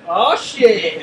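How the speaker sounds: loud burst of alarm; startled curse. Real, not acted. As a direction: blurt it out, caught off guard. Sudden danger in the game.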